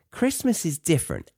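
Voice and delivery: ordinary delivery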